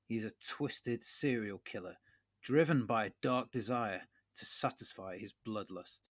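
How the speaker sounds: measured trailer-style narration with deliberate pauses; storyteller's dramatic film pitch; dramatic synopsis read. Acted. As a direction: read the horror blurb with mock gravity.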